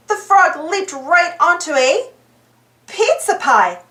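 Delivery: usual speaking voice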